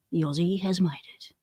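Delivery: soft unvoiced whisper, half to himself; whispered aside. Real, not acted. Streamer whispering to himself as a viewer arrives, hushed whisper. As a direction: breathe it like a secret.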